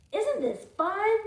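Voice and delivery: put-on high, loud, delighted kid; gleeful. Teacher voicing Wendell asking Sophie, gleeful and loud. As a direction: bounce it out with glee.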